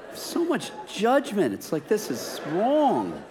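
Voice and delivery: high-pitched, hammed-up protest; mock indignant whining. Overlooked preacher complaining to the Lord, mock indignant whining. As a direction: plead your grievance in comic indignation.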